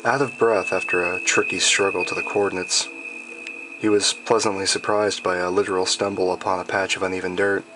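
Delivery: ordinary delivery, nothing marked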